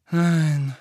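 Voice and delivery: ein lauteres, fallendes Seufzer-Nein; seufzend. A narrator's reading. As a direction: lass das Nein resigniert ausatmen. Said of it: "seufzte sie". (seufzend, lauter und fallend)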